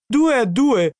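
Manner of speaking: high, elated yell. A narrator's reading.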